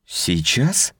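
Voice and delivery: high, startled question; taken aback. Acted. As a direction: caught off guard, unsure. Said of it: "растерялась она".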